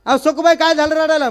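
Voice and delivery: lightly played father-in-law demanding to know; acted puzzled questioning. Father-in-law questions crying women in turn. (चढ्या आवाजात विचारणारा सासरा)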